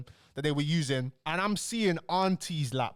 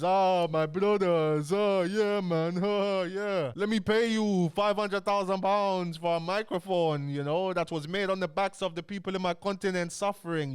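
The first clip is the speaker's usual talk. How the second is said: hammed-up sucking up to big names, stretched and raised; mock fawning; 3 semitones higher than usual. Pastor greeting his famous "brothers", mock fawning. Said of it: "When it comes to age olders".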